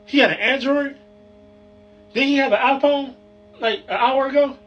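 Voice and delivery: high, puzzled rising questions; suddenly realising something's off; confused disbelief. Acted. Phone number suddenly gone.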